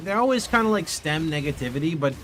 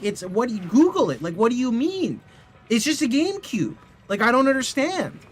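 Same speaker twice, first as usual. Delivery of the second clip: exasperated; 8 semitones higher than usual. Retold or imitated. Exasperated retelling to security.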